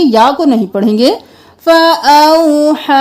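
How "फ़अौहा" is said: long drawn-out final vowel; slowly recited फ़अौहा with the final हा held long; tajweed stretch. Shown as a demonstration. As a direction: stretch the last vowel slowly, four counts. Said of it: "इसको चार अलिफ के बराबर खींचेंगे".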